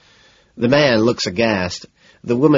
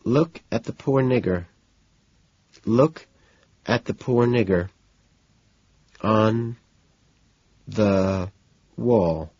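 halting, with long gaps between words; resume reluctantly, stopping word by word; hesitant reading; slower than usual. Acted. Woman resuming his disturbing poem, hesitant and faltering. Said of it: "then hesitantly resumes".

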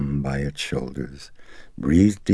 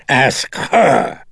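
high, loud outburst; demon's sharp command; 10 semitones higher, 6 dB louder than usual. Acted. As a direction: throw it out as a shouted order.

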